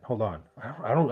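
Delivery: normal talking voice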